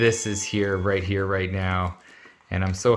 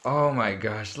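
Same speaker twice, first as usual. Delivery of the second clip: normal talking voice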